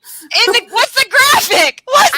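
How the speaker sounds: high, puzzled plea for the graphic; confused. Real, not acted. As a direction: ask where it is, baffled. Confused, can't find graphic.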